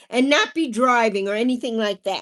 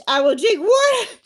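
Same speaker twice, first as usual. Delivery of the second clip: mock cheer; 7 semitones higher, 5 dB louder than usual. Mock cheer, high loud.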